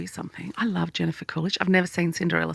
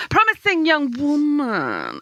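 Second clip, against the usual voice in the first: higher, slow cartoon-lion voice; Snagglepuss voice; 8 semitones higher, 3 dB louder, slower than usual. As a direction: drawl it like Snagglepuss. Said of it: "doing Snagglepuss".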